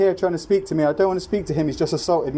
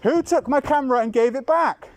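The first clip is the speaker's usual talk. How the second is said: raised, insistent calls, higher pitch; yelling across the room; 7 semitones higher than usual. Real, not acted. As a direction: call out demanding across the room. Keeps demanding across room.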